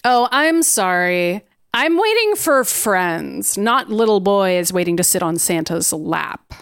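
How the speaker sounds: slow, haughty brush-off. Acted. M dismissing boys waiting for Santa, slow and condescending. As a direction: draw out a mock apology. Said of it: "that's classic M".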